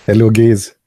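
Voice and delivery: "gays" with an ay vowel in place of guys; "gays" said for guys in an everyday voice; mispronounced GUYS. Retold or imitated. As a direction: repeat the slip, saying "gays" for guys. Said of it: "I noticed I was saying".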